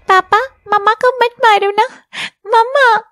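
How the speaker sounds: put-on a small girl sobbing at her father's feet; crying little girl. Little Pihu begs her papa to stop hitting mamma, tearful and high. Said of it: "रोते हुए".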